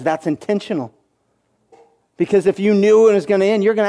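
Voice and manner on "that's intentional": lower, softer, calm reply; soft voice of God. Retold or imitated. God answering the frustrated believer, low and soft.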